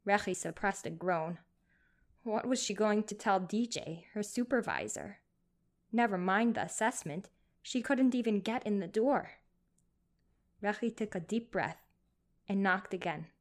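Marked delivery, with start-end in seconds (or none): none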